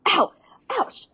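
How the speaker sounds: high little yelps of pain; mock yelp. Retelling a clumsy scene, mock pain yelps. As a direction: squeal ow like she bumped the table. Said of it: "and she goes".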